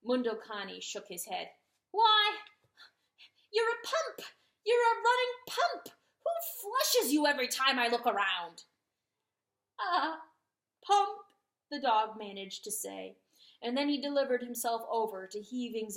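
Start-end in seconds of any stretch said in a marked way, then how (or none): 1.9-8.7 high, loud scornful scolding; mocking rooster scolding. A narrator's reading. Rooster mocking the dog's endless tears. 9.8-11.2 a hint of sobbing. A narrator's reading. Dog sobbing.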